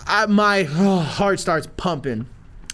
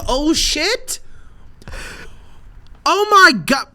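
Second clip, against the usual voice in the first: high, loud panicked rant; alarmed disbelief; 8 semitones higher, 4 dB louder than usual. Retold or imitated. Storyteller hearing the snake handler is worried, panicked disbelief. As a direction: pitch climbing in exasperated alarm.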